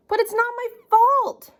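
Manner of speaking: put-on high, pleading child protesting; whiny kid voice. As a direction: whine it like a caught kid. Child whining excuse.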